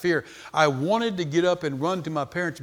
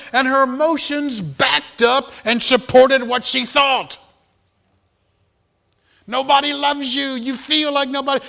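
loud, high, driving sermon voice; preaching; 9 semitones higher, 9 dB louder than usual. Acted. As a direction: hammer the message home to the congregation. Preaches the point at full force.

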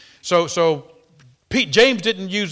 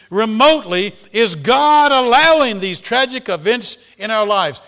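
rising, louder sermon build; fiery preaching; 7 semitones higher, 8 dB louder, slower than usual. Acted. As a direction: preach it higher and louder, drawing it out. Preaching at full force.

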